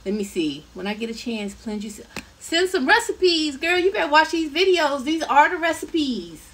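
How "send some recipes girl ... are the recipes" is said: playful scolding. Real, not acted. Teasing viewer asking for recipes.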